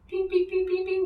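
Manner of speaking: high electronic pings; computer pinging. Acted. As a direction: chirp it like a console beep. GM imitates a computer ping.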